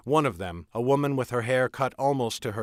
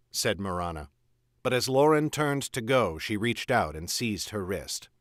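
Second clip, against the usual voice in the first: plain reading voice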